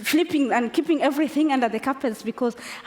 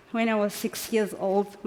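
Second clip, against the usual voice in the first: ordinary talk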